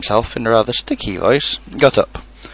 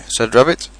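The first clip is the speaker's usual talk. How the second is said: ordinary delivery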